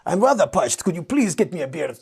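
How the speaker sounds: grandly polite, savouring each fancy word; mock-elegant gentleman ordering a beer; posh eloquent speaker. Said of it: "You're speaking like this".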